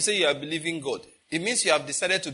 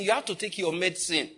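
usual speaking voice

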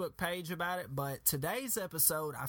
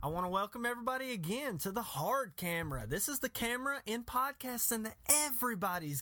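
high, hyped radio host; announcer voice; 9 semitones higher than usual. Acted. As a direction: sell the comeback like a showman. Host returns from break.

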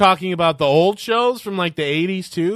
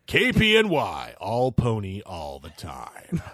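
radio announcer; 7 semitones lower, 4 dB quieter, slower than usual. Pretend. Mock radio slogan.

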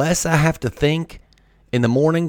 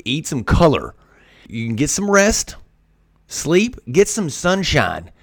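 ordinary talk, nothing marked

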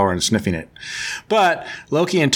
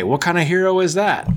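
raised pitch, needling question; mock goading; 6 semitones higher than usual. Loki goading Odin about his son, mock indignant. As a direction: egg him on, play-acted. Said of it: "he's whispering in Odin's ear".

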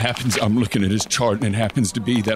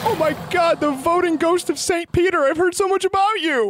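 awed high voice. Acted. Awed meeting with ghost saint.

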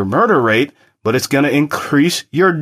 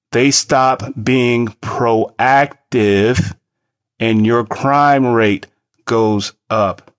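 very slow, each word laid down; over enunciating; slower than usual. Shown as a demonstration. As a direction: spell it out slowly for them. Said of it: "let me say something again, really slowly".

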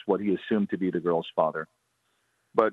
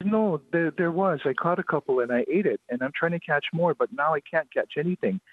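put-on high-pitched, plaintive little-girl voice; pitiful child's protest; 7 semitones higher than usual. Little girl insists she caught moi before, acted pitiful whine. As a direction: protest like a tearful small child. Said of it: "she's crying more pitifully".

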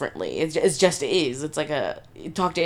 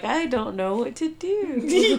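nervous laugh; 8 semitones higher, slower than usual. Retold or imitated. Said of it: "your nervous laugh is".

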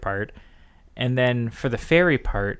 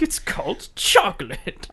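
a touch higher, slow and smug; prim explainer; 6 semitones higher, slower than usual. Retold or imitated. Prince presenting chocolate, slow smug mock voice. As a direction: announce it like a grand reveal. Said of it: "He's like".

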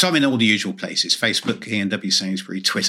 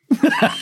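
laugh; 4 dB louder than usual. Real, not acted. Laughs at own joke.

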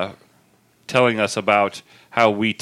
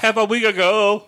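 high incredulous echo; surprised; 10 semitones higher than usual. Real, not acted. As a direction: repeat it in disbelief.

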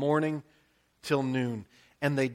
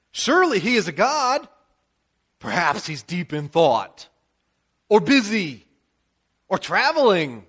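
louder, higher sarcastic jeering; mocking taunt; 5 semitones higher, 5 dB louder than usual. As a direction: needle the rival prophets with mock concern. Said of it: "Elijah began to trash talk".

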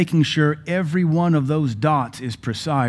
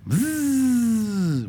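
long high-pitched buzz, sliding down at the end; imitated spinner buzz; 10 semitones higher than usual. Imitates a spun finger spinner.

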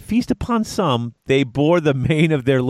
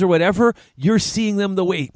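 ordinary talk, nothing marked

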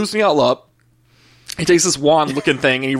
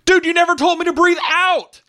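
much higher, strained mock-panic yelp; exasperated squeal. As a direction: squeak it out in breathless disbelief. Retold exasperated outburst at sonographer.

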